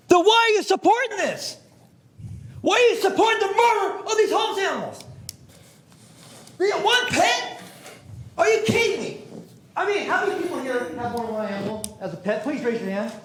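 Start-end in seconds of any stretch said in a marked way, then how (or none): 0.1-11.3 furious, much higher and shouted; angry shout. Real, not acted. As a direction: yell at the board in outrage. Angry speaker shouting at the board.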